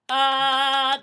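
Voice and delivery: loud chest "ah". Shown as a demonstration. Coach shows the natural chest voice, loud. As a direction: give a full chest "ah". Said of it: "the chest is like".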